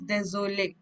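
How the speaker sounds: désolé with the accented é sounded; careful désolé, sounding its accented é; accented é pronounced. Shown as a demonstration. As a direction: say désolé, voicing the é that carries the accent. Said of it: "we have to pronounce that letter".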